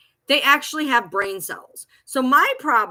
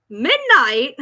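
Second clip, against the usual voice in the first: usual speaking voice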